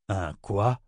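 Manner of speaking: puzzled short question; astonished. A narrator's reading. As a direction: ask it in mild disbelief. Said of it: "s'étonna Chase".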